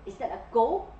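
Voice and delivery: ordinary delivery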